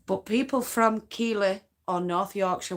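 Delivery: usual speaking voice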